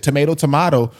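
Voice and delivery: "Tomato, tomato" said first as tuh-MAY-to then as tuh-MAH-to; "tomato" with a long A, then "tomato" with an ah; tomato twice. Shown as a demonstration. Host shows the saying to his South African guest, two pronunciations of tomato. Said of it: "nobody actually says tomato".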